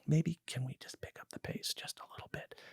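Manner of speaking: hushed, unvoiced whisper. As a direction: whisper it so the crew can't hear. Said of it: "I would whisper".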